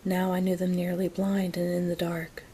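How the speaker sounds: ordinary delivery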